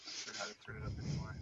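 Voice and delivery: faint, far from the mic; speaking too far from the microphone; barely audible. Real, not acted. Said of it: "are you close to your microphone?".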